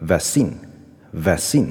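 vaccine with stress wrongly on the second syllable, ve-SEEN; common learner mispronunciation. Shown as a demonstration. Teacher showing how students often say vaccine, stress on the last syllable. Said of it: "学生往往会念成".

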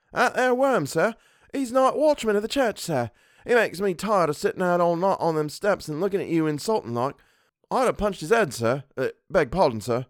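high-pitched, indignant Cockney servant; Cockney dialect. A narrator's reading. Servant complaining to his employer about the watchman, in indignant Cockney. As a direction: grumble like an affronted Cockney. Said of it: "Thomas's Cockney dialect".

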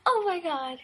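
gasp, then high-pitched mock-offended exclamation; mock shock. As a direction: act scandalized with a high gasp. Mock shock at being cut off.

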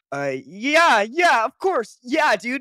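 high loud bro yes; eager agreeing. Retold or imitated. Jacob agreeing to the fan's photo, high eager bro. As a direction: overeager bro saying yes. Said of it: "Jacob went like this".

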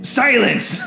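high, loud in-character outburst; sudden roar for silence; commanding shout. Acted. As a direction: shout them down as an angry chieftain. Chieftain cuts off the intruders.

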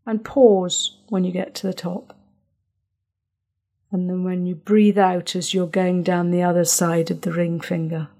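soothing guided breathing; guided breathing script. Shown as a demonstration. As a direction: talk them calmly through each breath.

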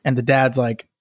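normal talking voice

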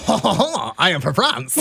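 hammed-up French accent, laughing; mock French accent. Radio co-host asked to tell the story in French accent, hammed-up French. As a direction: put on a playful French accent. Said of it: "tell the story in a French accent".

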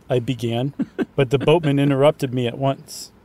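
plain reading voice

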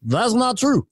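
high-pitched politician impression; Obama. Shown as a demonstration. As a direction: mimic a president's measured denial. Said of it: "How about Obama?".

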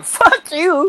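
mock retort. Crash retorts in retold feud.

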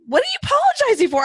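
high, rushed exasperated question; mock incredulous. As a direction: react in playful disbelief at a needless apology. Retold baffled reaction to mom's apologies.

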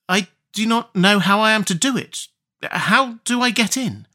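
put-on high, wide-eyed girlish questions; feigned innocent girl. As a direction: play innocent to fool the witch. Gretel plays dumb.

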